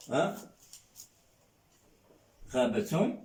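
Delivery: ordinary talk, nothing marked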